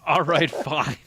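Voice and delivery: hammed-up indifferent engineer; mock dismissive guy. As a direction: toss it off like you don't care. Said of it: "That's what he said".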